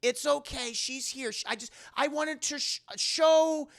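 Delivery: high-pitched soothing chatter; calm a nervous friend and a shy child spirit; acted grandmotherly fussing; reassuring grandma.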